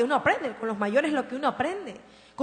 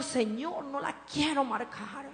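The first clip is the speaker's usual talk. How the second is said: soft, pleading prayer; hushed mother's plea; tearful prayer; 4 dB quieter, slower than usual. Retold or imitated. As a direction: beg God quietly, near tears. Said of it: "le he llorado y le he dicho".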